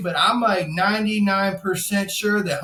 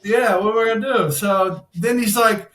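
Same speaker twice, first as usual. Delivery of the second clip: usual speaking voice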